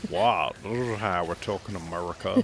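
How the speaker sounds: hammed-up American accent; mock American accent. Doctor Strange explaining to other doctor, mock American accent. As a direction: insist in a badly faked American accent. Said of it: "You have yourself a funny accent".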